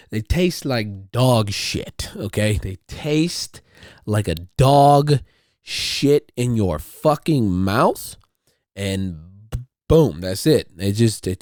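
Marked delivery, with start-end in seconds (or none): 2.6-8.2 slow, drawn-out disgust; emphatic. Real, not acted. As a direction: drag it out with disgust. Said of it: "emphatic disgust repetition".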